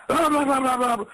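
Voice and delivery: high, mocking gibberish; mocking blah blah. Man mocks court officials' talk, high and mocking. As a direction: brush off their talk with a sneer.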